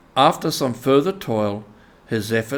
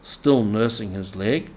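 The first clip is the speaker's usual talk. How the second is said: ordinary delivery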